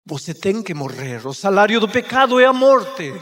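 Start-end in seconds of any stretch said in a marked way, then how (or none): 1.3-3.1 pulpit-strong sentence; emphatic proclamation. Real, not acted. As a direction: declare it loud and weighty. Preacher proclaims sin's penalty.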